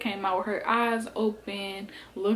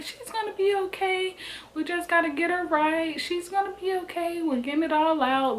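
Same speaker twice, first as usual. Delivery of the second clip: reassuring; 9 semitones higher than usual. Retold or imitated. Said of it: "they made us feel so comfortable".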